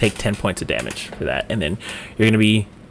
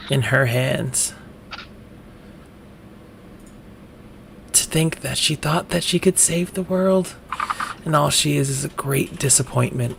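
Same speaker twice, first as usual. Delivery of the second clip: hushed, growing softer, a mild contempt; parents' fused voice fading into a whisper; lightly voiced shaming parents; 2 semitones higher, 6 dB quieter than usual. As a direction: let the disappointment sink in quietly. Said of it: "they look down upon you and they say".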